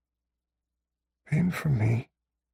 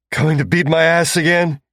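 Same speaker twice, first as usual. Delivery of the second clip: defiant taunt; 6 semitones higher, 6 dB louder than usual. A narrator's reading. Taunting his captors.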